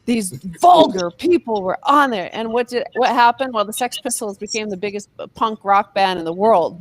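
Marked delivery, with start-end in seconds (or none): 0.1-2.3 mock outrage. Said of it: "all the headlines were like".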